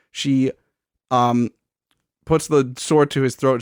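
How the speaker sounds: normal talking voice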